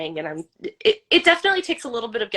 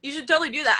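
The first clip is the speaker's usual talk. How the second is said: normal talking voice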